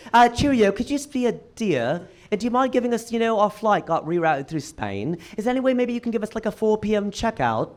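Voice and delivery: hammed-up British accent, politely pleading; Mo Amer's British accent. Friend asks front desk for late checkout, mock British accent. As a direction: do a rough impression of a British caller. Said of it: "doing an impression of a British guy".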